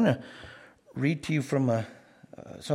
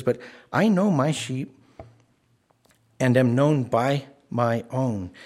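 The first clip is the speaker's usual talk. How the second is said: slow, emphatic quote; 4 dB louder, slower than usual. Acted. Quote slowed, louder.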